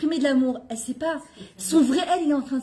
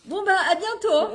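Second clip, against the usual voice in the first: higher, slow, laughing almost crying; a slow, laughing, teary goodbye; 8 semitones higher, 3 dB louder than usual. Real, not acted. Mother's flustered goodbye.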